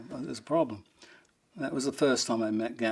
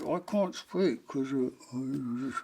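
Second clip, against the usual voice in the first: imitated clenched-teeth mumble, drawn out; jaw wired shut; much slower than usual. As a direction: talk through a locked jaw. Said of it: "his jaw was wired shut".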